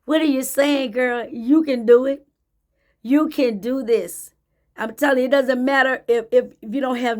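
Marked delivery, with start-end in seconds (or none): none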